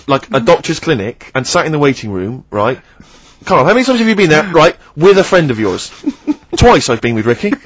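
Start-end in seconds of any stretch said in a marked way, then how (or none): none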